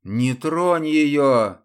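громко и быстро выпалить; выпалить как приказ; резкий выкрик. Acted. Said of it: "Мирон выпалил". (громкий выкрик)

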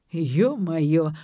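understated squeal of dismay; mock yelp. A narrator's reading. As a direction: yelp it in an acted father's voice. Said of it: "взвизгнул отец".

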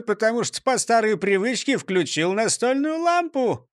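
high jolly mayor voice; beaming, puzzled mayor; cheerful mayor. A narrator's reading. Mayor voice again.

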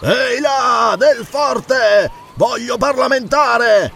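high, drawn-out hail; angry shout. A narrator's reading. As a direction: call up to the fort across a distance. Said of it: "lanciò un urlo rabbioso".

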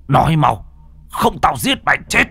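high, rising angry shout; furious threat. Acted. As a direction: threaten at full pitch. Threatens the killer.

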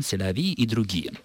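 ordinary talk

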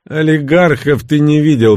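lightly gruff dismissal; mild grumble. A narrator's reading. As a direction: grumble back at the teasing. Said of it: "проворчал тот".

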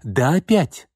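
sharp indignant retort; flaring up. A narrator's reading. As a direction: bristle and fire it back. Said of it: "вскинулась она".

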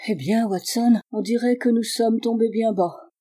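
plain reading voice

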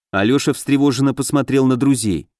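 plain reading voice

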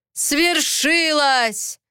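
high, long-stretched triumphant cry; narrated roar of fanatic joy; exultant yell. A narrator's reading. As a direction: proclaim it, drawing the word right out. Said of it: "заорали все четверо старейшин".

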